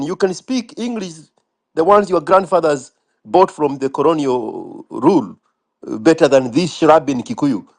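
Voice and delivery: usual speaking voice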